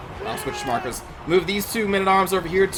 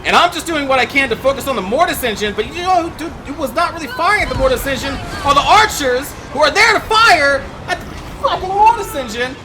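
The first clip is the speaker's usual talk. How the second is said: frustrated rant; 6 semitones higher than usual. Real, not acted. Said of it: "the emotion's still pretty tense".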